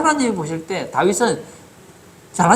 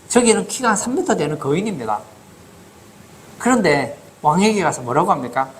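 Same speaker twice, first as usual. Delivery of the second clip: normal talking voice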